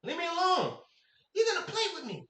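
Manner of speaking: put-on high, whiny child brushing her off; lightly voiced bratty kid. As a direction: snap back like an annoyed little boy. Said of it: "I'm like".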